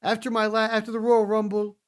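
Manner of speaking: imitating Drew McIntyre's self-pep talk; mock Drew McIntyre. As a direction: play him boasting to the mirror. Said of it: "he's like".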